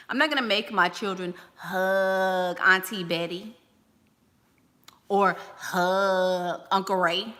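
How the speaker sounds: normal talking voice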